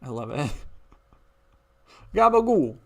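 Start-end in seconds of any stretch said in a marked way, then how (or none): 2.1-2.8 high, loud, hammed-up Italian gabagool; mock Italian accent. Streamer tossing in one more Italian word, mock accent. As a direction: toss it out like a Sopranos cliché.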